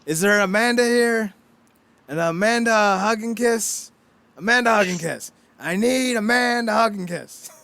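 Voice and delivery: imitating Bart's prank call. Host imitating Bart prank-calling Moe, hammed-up caller. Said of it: "the Simpsons running gag".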